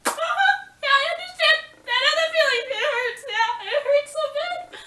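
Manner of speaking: high, loud, hammed-up fake crying; mock sobbing. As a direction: wail it like a melodramatic crybaby. Mocking her own heartbreak reply.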